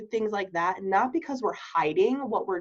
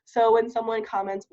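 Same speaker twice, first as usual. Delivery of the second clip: ordinary talk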